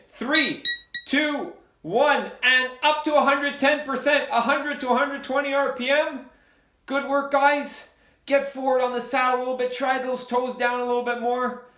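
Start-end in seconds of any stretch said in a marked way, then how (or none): 0.1-9.4 raised coaching call. Real, not acted. Coach starts the next sprint.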